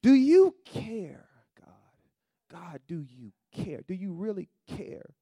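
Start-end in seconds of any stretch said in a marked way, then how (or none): 0.0-1.3 loud, high and slow, stretched cry to God; anguished cry. Acted. Preacher acting a desperate prayer to God, loud and slow. 1.6-2.5 breathy whisper; whispered plea. Acted. Preacher whispering the name of God in prayer.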